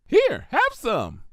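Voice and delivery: high, bouncy and cheerful; bubbly pony voice. A narrator's reading. Pinkie gushes to Twilight about her cookies, high and bubbly. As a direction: gush like a sugar-loving party pony.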